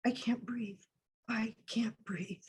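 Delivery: very soft, slowing plea; faint whispered plea. Acted. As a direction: fade to a near whisper. Quoting victim being smothered.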